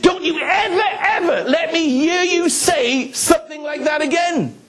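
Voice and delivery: wag a finger as a fierce gran, half laughing; stern gran. Retold or imitated. Gran warning her grandson after washing his mouth out, high laughing scold. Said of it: "She said".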